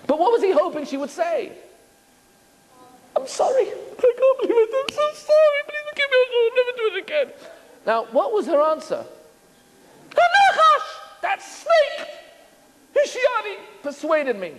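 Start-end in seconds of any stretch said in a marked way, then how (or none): 3.2-7.3 put-on high, whispery little-girl sorry; a high, breathy, childlike plea for forgiveness; pleading apology. Eve's hoped-for apology. 10.2-14.5 shrill-free but very high pointing finger; high, indignant blaming of the snake; high accusing whine. Pretend. Eve blames the snake.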